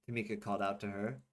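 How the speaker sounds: ordinary delivery, nothing marked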